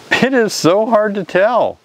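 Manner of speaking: normal talking voice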